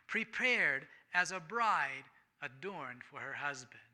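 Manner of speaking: loud, ringing Bible reading; scripture reading. Acted. Preacher reads Revelation to the congregation, ringing and loud. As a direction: proclaim the verses from the pulpit.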